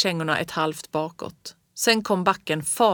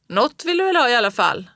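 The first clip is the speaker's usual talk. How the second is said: fast, louder and higher call; quick raised question into the wind; 5 semitones higher, 6 dB louder, faster than usual. A narrator's reading. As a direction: press him for an answer over the wind. Father calling through storm.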